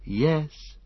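a subdued, regretful yes; understated sadness; sad. A narrator's reading. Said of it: "said Pippin sadly".

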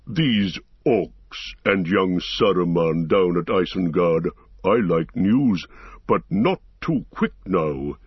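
slow, weighty tree-creature voice; Treebeard, unhurried and wary. Acted. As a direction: take your time over every word, pausing heavily.

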